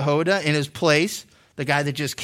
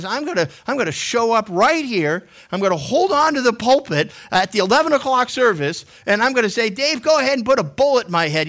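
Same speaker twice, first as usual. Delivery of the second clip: raised, emphatic acted challenge; higher-pitched, punchy mock defiance; defiant dare; 6 semitones higher, 4 dB louder than usual. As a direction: dare him boldly in front of everyone. Voicing a defiant public dare.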